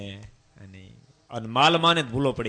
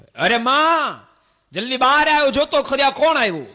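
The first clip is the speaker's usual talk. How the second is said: high, loud shout calling grandma outside; child yelling for his grandma to come out; excited call to mother; 14 semitones higher, 11 dB louder than usual. Acted. Young son shouting to grandma to come see, high and loud.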